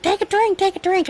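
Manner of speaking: high, imitating insistent helpers; high-pitched retold urging; imitated onlookers' urging. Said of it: "everybody's like".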